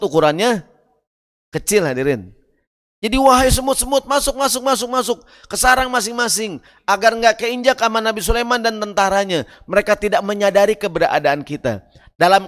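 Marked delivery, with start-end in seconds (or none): 3.2-9.4 high, hurried urging to hide; urgent ant king. Acted. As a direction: hustle the ants inside, urgently. Ant king urging ants inside.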